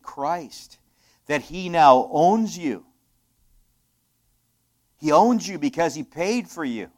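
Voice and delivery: loud, ringing preacher's declaration; preaching at full force. Real, not acted. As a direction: proclaim it to the congregation.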